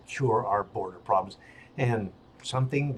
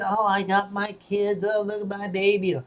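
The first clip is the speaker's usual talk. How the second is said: mocking whiny parent; 9 semitones higher, 4 dB louder than usual. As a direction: whine it like a parent playing for pity. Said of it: "And they bring their kids".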